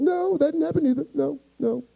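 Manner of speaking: high, softening tearful squeak; mock crying. Hurt man admitting to his friend, high mock-crying voice. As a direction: deny it through pretend tears. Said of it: "First off, stop crying".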